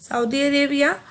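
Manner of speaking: normal talking voice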